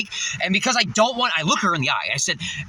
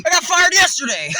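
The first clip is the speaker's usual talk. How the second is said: higher, louder cheerful confession; laughing excited; 9 semitones higher, 4 dB louder than usual. Real, not acted. Telling a stranger about getting fired, laughing and loud. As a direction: announce it like good news.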